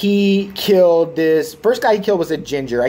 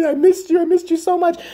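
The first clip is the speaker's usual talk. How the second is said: much higher whiny crying fan voice; mock weepy fan; 13 semitones higher than usual. Mocking fans who mourn a TV character, a high whine. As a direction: whimper it like a heartbroken fan.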